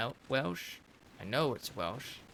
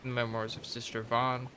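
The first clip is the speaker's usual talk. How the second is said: measured reading of a sister's memoir; lore narration; 6 dB louder than usual. Acted. Reading a game codex memoir to viewers, storyteller narration. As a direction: narrate it like an old chronicle. Reads codex entry about the pond.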